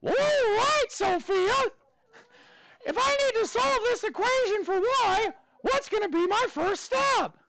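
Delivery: high, squeaky cartoon mouse voice held through the whole lesson; Mickey Mouse voice. Teacher quizzing his class on equations in a high Mickey Mouse voice. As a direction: teach the math steps as a cheerful cartoon mouse. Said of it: "I gotta do the voice too".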